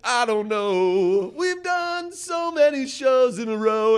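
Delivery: high, slow drawn-out star voice; mock weary rock star. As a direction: play the dazed touring star.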